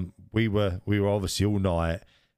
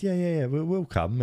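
eager yes; 10 semitones higher than usual. Retold or imitated. Eager agreement to medieval night.

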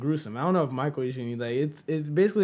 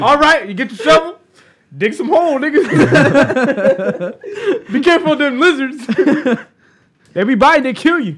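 loud, high mock orders between laughs; hammed-up overseer, cracking up; joking camp boss; 10 semitones higher, 13 dB louder than usual. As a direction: bark comic orders at the diggers. Jokingly plays camp boss from Holes.

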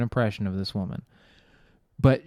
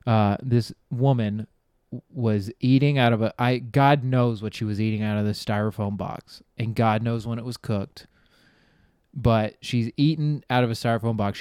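ordinary talk

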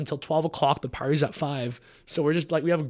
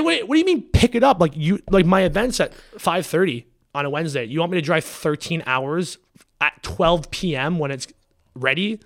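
furious disbelief; 5 semitones higher, 8 dB louder than usual. Retold or imitated. Scolding the supplier about picking up cans, outraged. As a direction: spell out the absurd distance in outrage. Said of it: "I got furious".

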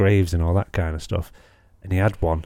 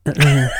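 short grunted tics, louder; imitated tic grunts; 6 semitones higher, 5 dB louder than usual. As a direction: mimic a vocal tic, clipped grunts. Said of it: "Kinda like that".

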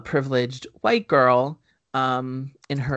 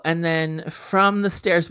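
normal talking voice

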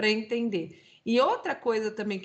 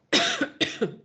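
sudden high choking cough. Real, not acted.

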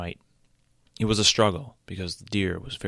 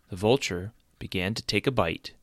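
plain reading voice

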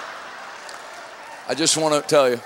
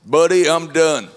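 slow, light Kentucky drawl of a pastor; a hint of Kentucky, unhurried and final; Kentucky accent; 3 semitones lower, 4 dB louder, slower than usual. Kentucky pastor refusing lockdown, slow light Kentucky accent. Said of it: "he said in his Kentucky accent".

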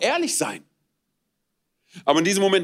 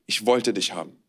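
God speaking tenderly; 6 semitones lower than usual. Acted. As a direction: speak as a loving father to his child. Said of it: "Dass Gott dich anschaut und er sagt".

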